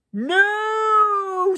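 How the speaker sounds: high, drawn-out haughty no; a stuck-up waiter turning her down; long, lofty, high-pitched no; snooty waiter. Acted.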